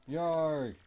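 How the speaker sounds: loud furious roar into a vow; angry Bowser. Acted. Bowser vowing to his minions to ruin Mario, loud angry roar. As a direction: bellow it like an enraged king.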